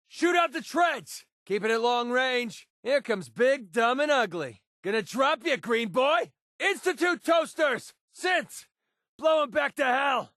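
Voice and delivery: high, forceful combat yells; yelled battle cries; battle shouting. Acted. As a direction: shout taunts and orders in a firefight. Combat barks at robots, mutants.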